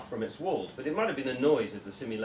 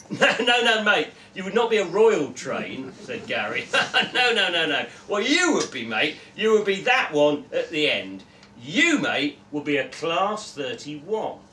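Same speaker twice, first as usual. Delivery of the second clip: louder, laughing dismissal, no no no; loud scoffing; 3 semitones higher, 7 dB louder than usual. Acted. Gary mocks Joe's bullet train choice, loud and scoffing. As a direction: brush him off with a laugh.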